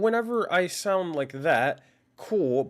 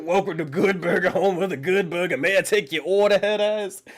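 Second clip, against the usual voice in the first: fast, louder mock fast-food cashier greeting; mock drive-thru worker; 5 dB louder than usual. Streamer mocks his mic as a drive-thru worker, hammed-up cashier voice. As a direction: rattle it off like a bored drive-thru clerk. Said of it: "Sounds like McDonald's drive-thru".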